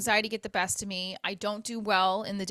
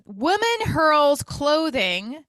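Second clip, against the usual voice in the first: slow, louder headline read; dramatic headline; 6 semitones higher, 9 dB louder, much slower than usual. Shown as a demonstration. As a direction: announce the headline slowly, with drama. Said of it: "I wanna read this".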